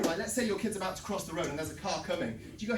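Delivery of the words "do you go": ordinary delivery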